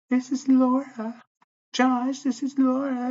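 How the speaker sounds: very high, slow, drawn-out mocking voice; property manager impression. Property manager announcing herself at his door, mocking falsetto. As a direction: mimic her in a slow falsetto singsong.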